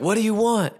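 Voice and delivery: high-pitched, pressing question; acting out the demanding guru; insistent demand. Guru demands answer from student.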